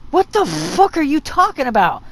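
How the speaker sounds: heated, exasperated question; incredulous retort. Acted. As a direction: fire it back in disbelief. Retort to clan blaming players who left.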